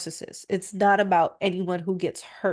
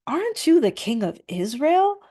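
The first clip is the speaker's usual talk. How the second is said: high, louder taunting wife; Jezebel scolding; 7 semitones higher, 4 dB louder than usual. A narrator's reading. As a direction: goad your husband, voice up high. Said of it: "Jezebel asked".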